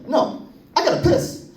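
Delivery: put-on high, strained little-boy urgency; a boy on second base who badly needs to pee; desperate kid. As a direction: squeak it out, bursting to pee.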